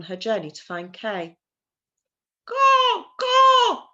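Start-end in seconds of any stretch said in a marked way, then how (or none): 2.4-3.9 high, loud cawing like a crow; shrill bird cry in the forest; crow's caw. A narrator's reading. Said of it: "What was that sound?".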